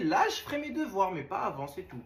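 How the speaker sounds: ordinary delivery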